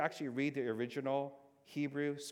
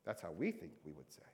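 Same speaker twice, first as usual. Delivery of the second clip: ordinary talk, nothing marked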